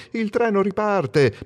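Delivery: high, slow half-shouted warning; mock shout. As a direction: shout a warning, lightly retold. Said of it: "gridandomi più forte".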